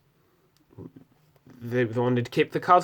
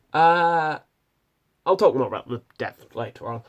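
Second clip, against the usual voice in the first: usual speaking voice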